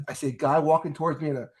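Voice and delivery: ordinary delivery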